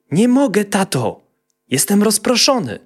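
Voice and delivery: put-on high, childish excuse; little boy voice. A narrator's reading. Little boy answering his impatient father. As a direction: whine it like a small boy.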